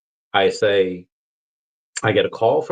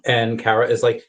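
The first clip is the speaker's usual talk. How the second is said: usual speaking voice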